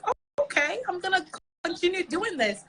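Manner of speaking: high-pitched, light resolve; retold pleased realisation. Comedian after people liked the party hosting, pleased realisation. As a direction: re-enact a happy "oh, okay".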